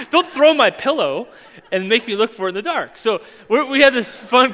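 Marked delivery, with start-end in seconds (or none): 0.1-3.0 louder, higher, laughing through the words; husband laughing at his wife. Retold or imitated. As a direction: scold her while laughing. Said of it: "I'm just like".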